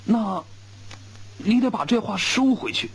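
high, tight indignant voice; a young soldier pushing back, face flushed; red-faced demand. A narrator's reading. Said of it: "他脸憋得红红地说".

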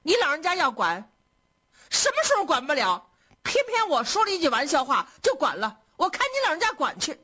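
high-pitched, huffy sarcasm; sulky, mocking challenge; cold sneer. A narrator's reading. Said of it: "冷笑着说".

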